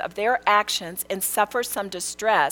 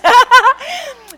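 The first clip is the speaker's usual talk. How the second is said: high, loud whooping laugh; loud delighted laugh; 13 dB louder than usual. Real, not acted. Preacher laughing at her answered prayer, loud whoop. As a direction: burst out laughing with joy.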